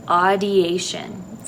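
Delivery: drawn out audiation slowly; slow teaching word. Shown as a demonstration. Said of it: "Say that word".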